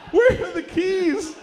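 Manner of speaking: slow, very high loud wail; mock crying. Dad begging for the Porsche, exaggerated tearful wail. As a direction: drag it out as hammed-up sobbing. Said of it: "He started crying immediately".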